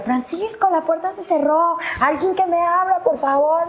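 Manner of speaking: súplica muy aguda y angustiada; pidiendo ayuda. Retold or imitated. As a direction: golpea la puerta y ruega. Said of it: "empiezo a golpear". (súplica aguda y angustiada)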